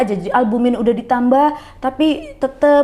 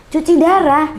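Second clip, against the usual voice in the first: a retold outraged "dialysis?!" pitched up and loud; indignant disbelief; 7 semitones higher, 6 dB louder than usual. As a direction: throw the words back in shocked protest. Indignant about dialysis news.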